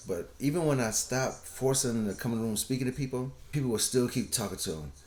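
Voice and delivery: ordinary talk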